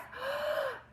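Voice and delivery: sharp high gasp of breath; huge gasp in. Acted. Dragon gulping air before fire, loud gasp.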